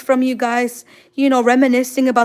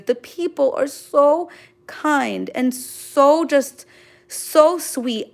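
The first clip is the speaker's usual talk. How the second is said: usual speaking voice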